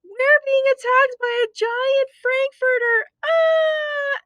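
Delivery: high, shrill cat voices; screaming frightened cats; panicked cats. Acted. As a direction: panic at the giant frankfurter. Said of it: "cried the cats".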